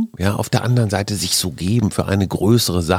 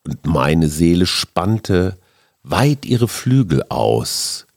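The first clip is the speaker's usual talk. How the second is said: slow, hushed poem reading; poem recitation; 4 semitones lower, slower than usual. Acted.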